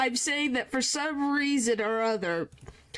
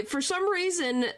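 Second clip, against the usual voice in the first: ordinary delivery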